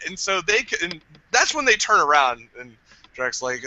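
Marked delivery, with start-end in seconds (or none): none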